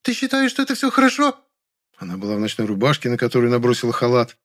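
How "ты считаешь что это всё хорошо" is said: high-pitched outraged female character voice; hysterical cry. A narrator's reading. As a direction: challenge in a high, frantic voice. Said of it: "закричала она не своим голосом". (истеричный крик высоким голосом)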